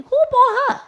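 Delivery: high, squeaky incredulous mock question; imagined snide onlooker. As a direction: squeal it like a gossip staring at her. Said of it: "they all thinking".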